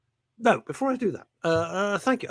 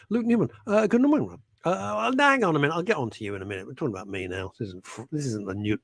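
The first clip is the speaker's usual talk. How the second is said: ordinary talk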